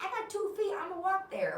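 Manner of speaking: high, loud mock bravado; mock self. Refusing to take an Uber, high proud chirp. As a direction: chirp it out like you're proud of walking. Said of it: "because I'm like".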